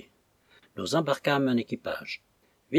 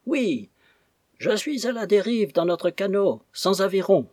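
high-pitched calling across distance; shouted answer; 8 semitones higher than usual. A narrator's reading. Captain adrift without oars answering his crew, shouted answer. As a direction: cry out for help from a drifting boat. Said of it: "nous cria-t-il".

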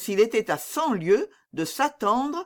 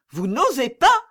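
higher, louder exasperated challenge; 6 semitones higher, 6 dB louder than usual. A narrator's reading. Princess to hesitant Manicamp, exasperated and louder. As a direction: throw his timidity back at him. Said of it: "dit madame exaspérée".

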